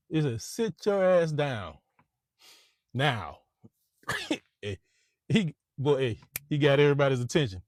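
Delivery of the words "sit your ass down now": commanding. Retold or imitated. Baldwin ordering salesman.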